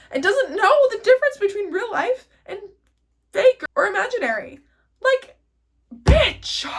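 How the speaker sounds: high, loud, laughing emphasis; laughing excitement. Real, not acted. Vlogger hyping viewers about the subconscious, laughing loudly. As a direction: build up laughing to a shriek.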